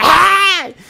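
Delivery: sharp high mock yelp; a showy high cry mocking pride; mock proud shriek. Shown as a demonstration. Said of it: "diga un grito de júbilo". (grito agudo burlón)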